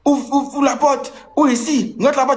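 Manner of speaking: cri joué, beaucoup plus aigu mais pas plus fort; cris imités. Retold or imitated. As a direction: singer l'ex qui hurle derrière la porte, très haut. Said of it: "tu as commencé à crier". (l'ex crie à travers la porte de l'hôtel, cri imité très aigu)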